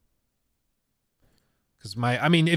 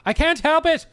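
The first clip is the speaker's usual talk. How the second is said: much higher, louder, slow cartoon Irish accent; 7 dB louder, slower than usual. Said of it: "talking like a cartoon version of an Irishman".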